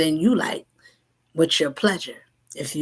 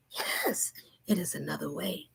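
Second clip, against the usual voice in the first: whispered, much softer; hushed whisper; 10 dB quieter than usual. Acted. Spirit whispers the secret to seekers. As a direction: breathe it out as a secret.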